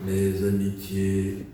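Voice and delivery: slow, low voice of a shadowy man; mysterious stranger. Acted. Same stranger's greeting comes back. (voix grave et lente d'inconnu mystérieux)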